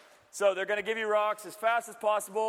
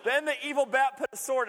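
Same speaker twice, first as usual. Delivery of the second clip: storybook reading; 5 semitones higher than usual. Acted. Rereads the fable.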